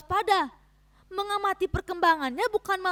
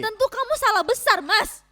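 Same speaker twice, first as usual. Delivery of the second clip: higher, louder sharp rebuke; angry retort; 6 semitones higher, 6 dB louder than usual. Acted. Wife snapping back at her husband, louder and higher. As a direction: push back hard at him.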